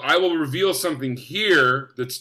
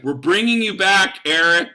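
emphatic promise, higher and louder; Amy Poehler; 4 semitones higher, 6 dB louder than usual. Retold or imitated. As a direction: announce the good news with raised, keen voice. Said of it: "they mean it in the moment".

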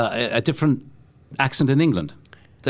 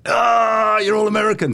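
high, raised, teasing exclamation; mock-scandalised shout, pitched way up; family's mock outcry; 9 semitones higher, 4 dB louder than usual. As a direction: imitate the family crying out at an Americanism. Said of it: "what would your family say".

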